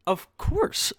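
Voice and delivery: high delighted of course; eager yes. Retold or imitated. Accepting the massage chair offer, high and eager.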